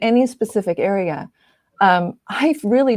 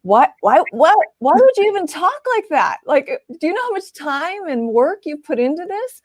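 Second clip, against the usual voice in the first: imitating husband, higher and incredulous; husband's disbelief; 8 semitones higher, 3 dB louder than usual. Husband rejecting her self-doubt, mock incredulous and higher. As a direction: play the baffled husband, rising in protest. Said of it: "And he'd be like".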